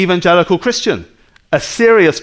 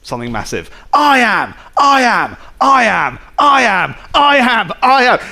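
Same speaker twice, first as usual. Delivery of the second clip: mock bragging, louder and higher; each I am pushed up louder than the last; boastful self-praise; 7 semitones higher, 3 dB louder than usual. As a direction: ham up a pompous braggart repeating himself. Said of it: "he says".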